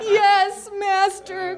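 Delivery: high acted cry of surrender; eager, high-pitched submission; submissive cry. A narrator's reading. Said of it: "cried Tony".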